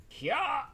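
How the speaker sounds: high, sharp "hya" of exertion; effortful battle cry. Acted. Player heaving a sword free of the monster, high battle cry. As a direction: let out a martial yell as you heave.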